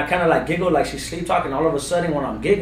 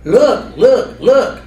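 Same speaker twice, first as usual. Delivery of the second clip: higher and louder, pleading look look look; urgent adult voice; 8 semitones higher, 9 dB louder, much slower than usual. Retold or imitated. Daughter urging her father to see something, high and loud. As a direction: call out like a scared grown-up pointing. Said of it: "like if she was an adult trying to tell me".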